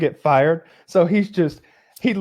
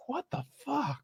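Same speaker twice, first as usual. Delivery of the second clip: high breathless disbelief; stunned hushed; 11 semitones higher, 14 dB quieter than usual. Retold or imitated. Woman seeing the cigar Indian, stunned hush. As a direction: gasp it under your breath. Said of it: "she's like".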